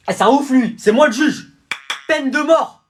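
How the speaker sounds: yelling judge, high and loud; furious shout. Acted. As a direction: bellow the sentence. Judge raging in the courtroom.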